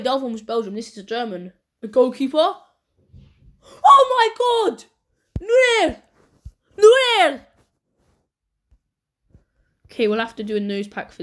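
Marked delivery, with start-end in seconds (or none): 3.8-7.4 much higher, loud shriek of joy; ecstatic shout. Real, not acted. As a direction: scream the name in disbelief. Opens a top goalkeeper card.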